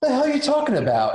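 high, quick mock disbelief; baffled retort. Driver answering the cop's strange question, baffled. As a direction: retell a startled, high-pitched comeback. Acts out baffled reply to cop.

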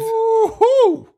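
high, drawn-out woo-hoo; mock cheer. Co-host cheering the movie's rating, high mock whoop.

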